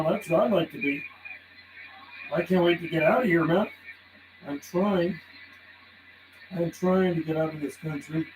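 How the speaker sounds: usual speaking voice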